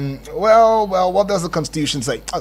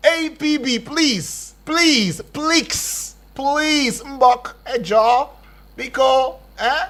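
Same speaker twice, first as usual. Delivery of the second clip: pleading call; 6 semitones higher, much slower than usual. Real, not acted. Host pleading with agency.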